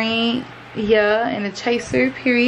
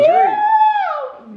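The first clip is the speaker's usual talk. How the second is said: excited whoop; 4 dB louder than usual. Real, not acted. Cheering at end of challenge.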